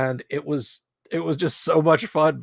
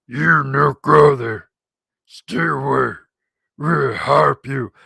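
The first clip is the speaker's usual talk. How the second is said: slow, loud mangled goblin voice; hammed-up creature pidgin; funny goblin voice; 3 semitones higher, 9 dB louder, slower than usual. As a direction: bark out broken English like a goblin. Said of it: "he puts on this funny voice".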